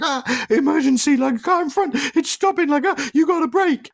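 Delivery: high, loud, alarmed squeal of a fretting car; panicky car voice. Retold or imitated. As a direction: voice the car panicking over the car in front. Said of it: "So it was like".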